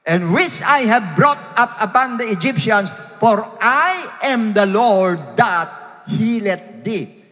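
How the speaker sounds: slow, forceful Bible proclamation; preacher declaiming scripture; emphatic scripture reading. Acted. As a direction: read the verse out phrase by phrase, punching words. Reads King James verse aloud.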